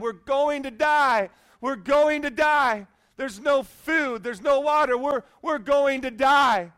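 loud, high, drawn-out wail of doom; acted panic. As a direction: wail like a panicking castaway, hammed-up. Panicking castaway pounding the sand.

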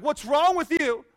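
loud, high, exasperated panic; ticked off. Acted. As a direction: act a frantic castaway yelling at his calm friend. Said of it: "First guy gets ticked off".

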